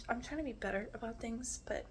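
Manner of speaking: normal talking voice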